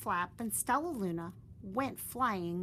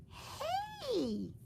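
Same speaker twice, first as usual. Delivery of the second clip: very high calling bat; high bright hey; loud voice. Acted. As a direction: call out to the stranger. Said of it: "a loud voice said".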